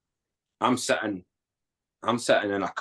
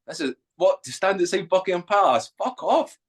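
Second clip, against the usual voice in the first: incredulous disbelief; 10 semitones higher than usual. Retold or imitated. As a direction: pitch climbing much higher in mock disbelief. Retold disbelief at London posting.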